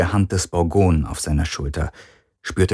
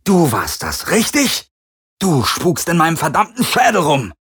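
angry accusation; 9 semitones higher than usual. A narrator's reading. Garland accuses the Helix.

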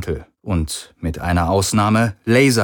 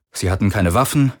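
plain reading voice

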